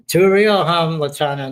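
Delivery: loud, high call out to a viewer. Real, not acted. Calling out to viewer Lachana, loud and high. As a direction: shout it across to Lachana.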